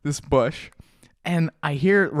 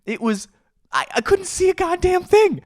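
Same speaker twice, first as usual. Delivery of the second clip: usual speaking voice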